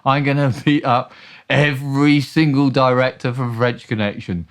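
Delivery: hammed-up threat from an enraged rock star. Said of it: "[name] was absolutely furious".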